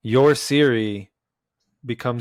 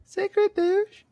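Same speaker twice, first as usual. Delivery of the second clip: much higher mock Australian woman voice; falsetto Australian accent. Still hamming the female Aussie voice for friends, high falsetto. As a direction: squeak it out, Aussie lady style.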